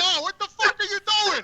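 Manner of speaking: high, drawn-out mock yell; retold yell. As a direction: call up to a window, no real volume. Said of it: "I yell up the window".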